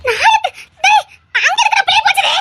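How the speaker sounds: high, panicked dismay; aghast and distraught; horrified outcry. Acted. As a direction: break off and cry out in alarm. Horror at how far girl went.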